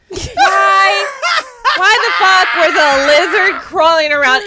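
loud, half-laughing cry of disbelief; exasperated yell. Real, not acted. Woman vents to friends about a lizard indoors, exasperated yell. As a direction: yell it out in comic outrage.

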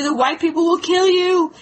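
mock panicked husband repeating himself; a touch of alarm; frightened insisting. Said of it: "her husband freaked out".

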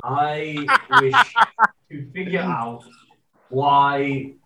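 lightly put-on Liverpool accent, slow; British accent. Wondering about a benched player in a light Liverpool accent. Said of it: "going into a little bit of [name]".

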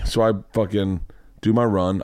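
ordinary delivery

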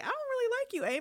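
high-pitched mock dislike; retold disapproval. Viewer reacting to rude Aemond, high-pitched disapproval.

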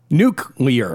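nuclear slowly as "NOOK-lee-er"; corrective pronunciation. Shown as a demonstration. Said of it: "is it nuclear".

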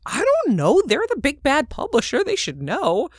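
high and loud, wide-eyed and helpless; naive helpless reply. Retold or imitated. Retelling her answer to her lawyer about the publisher, high and loud with naive helplessness. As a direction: play the clueless newbie deferring to the experts. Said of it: "And I was like".

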